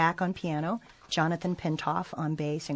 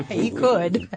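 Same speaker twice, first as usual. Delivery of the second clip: laughing through the words at the host's joke; 5 semitones higher, 7 dB louder than usual. Real, not acted. Guest answering host's joke, laughing. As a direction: let the laugh carry it.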